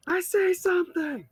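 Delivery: high, loud alarm call; lookout's shout. Acted. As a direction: shout it out like an archer on watch. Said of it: "one of them shouts".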